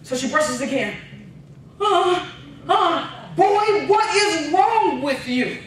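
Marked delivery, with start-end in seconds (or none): 1.8-3.1 gasping huh. Retold or imitated. Brother gasps from icy hot. 3.4-5.6 high, louder, slow exasperated mom. Retold or imitated. Mom scolds gasping son.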